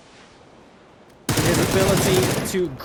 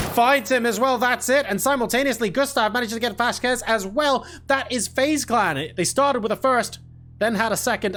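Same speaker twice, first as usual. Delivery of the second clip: higher-pitched, triumphant esports commentary; excited victory call; 5 semitones higher than usual. Real, not acted. Caster announces FaZe Clan winning the game, excited.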